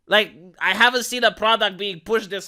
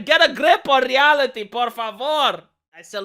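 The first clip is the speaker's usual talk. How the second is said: usual speaking voice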